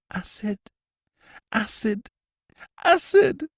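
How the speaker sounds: high, terrified gasps building to a cry; panicked prisoner, rising to a shriek; gasped in terror. A narrator's reading. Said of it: "gasped the prisoner".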